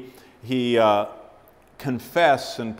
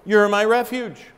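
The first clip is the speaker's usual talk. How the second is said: much higher and louder, awed declaration; loud exclamation; 8 semitones higher, 9 dB louder, slower than usual. Retold or imitated. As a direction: proclaim it to the whole room. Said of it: "and I says".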